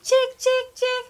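high, loud sing-song call of chick, chick, chick; wolf coaxing the chicks with high calls. Shown as a demonstration. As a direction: lure them in with a bright high call. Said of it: "And I go".

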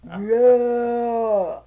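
long, loud, drawn-out yeah. Retold or imitated. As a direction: bellow the yeah out. Silas's loud drawn-out answer.

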